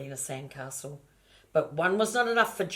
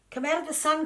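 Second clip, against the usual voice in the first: calling out; 12 semitones higher, 4 dB louder, faster than usual. Acted. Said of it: "called Pauline".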